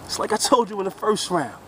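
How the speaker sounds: normal talking voice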